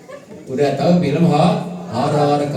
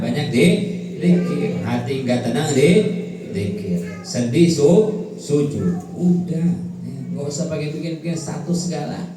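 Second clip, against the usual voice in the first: ordinary delivery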